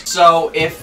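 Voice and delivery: normal talking voice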